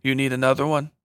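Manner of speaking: put-on lightly Mexican-accented question, rising at the end; a hint of a Mexican accent. A narrator's reading. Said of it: "in her light Mexican accent".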